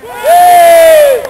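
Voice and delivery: high drawn-out holler; long shout. Real, not acted. Long shout across the pitch.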